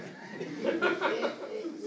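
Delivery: a short real laugh at her own joke; bright laugh. Real, not acted. Laughing at her joke about her husband, amused laugh. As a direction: laugh it off lightly.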